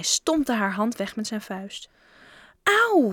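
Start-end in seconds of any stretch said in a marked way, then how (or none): 2.9-3.1 acted yelp of pain; cried out in pain. A narrator's reading. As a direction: play a girl's hurt cry. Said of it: "riep ze". (uitgeroepen van pijn)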